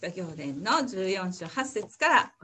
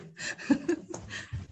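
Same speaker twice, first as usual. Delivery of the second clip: airy whispered laugh; nervous giggle; 10 semitones higher than usual. Real, not acted. Nervous before reading scripture.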